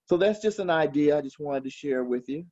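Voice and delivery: normal talking voice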